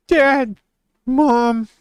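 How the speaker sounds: high, loud mock pet voice; dog talking, high and loud; voicing the dog. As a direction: speak for the dog in a squeaky bratty voice. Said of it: "She's like".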